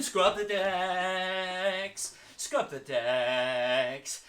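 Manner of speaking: very slow, loud drawn-out call; called command. Shown as a demonstration. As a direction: drag each word like a long scrub. Game command.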